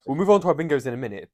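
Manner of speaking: ordinary delivery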